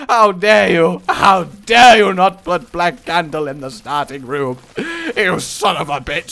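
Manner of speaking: loud, theatrical how dare you; hammed-up fury at the game; mock outrage.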